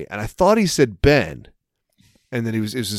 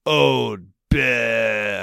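put-on Boston accent. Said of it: "he just responded with".

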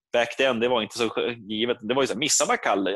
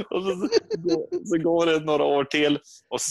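ordinary talk

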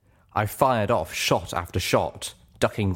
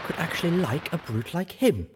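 higher, lighter mare voice; Velvet's voice; 9 semitones higher than usual. A narrator's reading. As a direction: voice her as the other mare. Velvet's voice, quoted.

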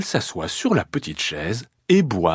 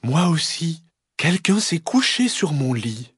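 higher, sweet mother voice; gentle mama bear; 7 semitones higher than usual. Acted. Mama bear finding her bed used, gentle high voice. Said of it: "dit de sa voix douce".

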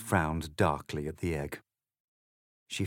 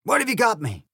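barked; 14 semitones higher, 7 dB louder, faster than usual. Acted. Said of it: "barked the egg".

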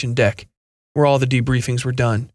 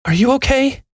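much higher, anxious female voice; worried question; 12 semitones higher than usual. A narrator's reading. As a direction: ask it with anxious concern. Worried operator asks.

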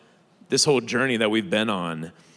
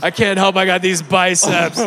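louder, higher mock complaint; mocking brag; 6 semitones higher, 7 dB louder than usual. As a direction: play up a fake burden. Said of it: "Boo-hoo".

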